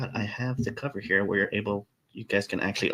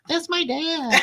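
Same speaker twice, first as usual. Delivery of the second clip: squeaky character voice; much slower than usual. Pretend. Jokingly voicing a character.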